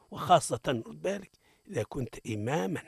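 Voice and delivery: ordinary talk, nothing marked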